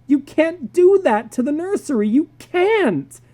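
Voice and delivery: put-on high shrieking child voices; children screaming. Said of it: "They screamed and pranced".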